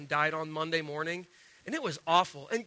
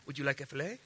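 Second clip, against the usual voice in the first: lightly voiced soft waiter offer; a hint of a hushed Brazilian server; 6 semitones lower, 9 dB quieter, faster than usual. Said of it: "somehow Brazilian guys are talking like".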